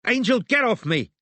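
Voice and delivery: high, loud roar. A narrator's reading. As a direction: roar at the girl pulling her. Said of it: "Robin roared".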